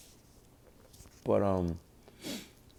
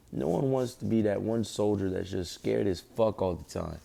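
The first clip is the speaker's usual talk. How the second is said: ordinary talk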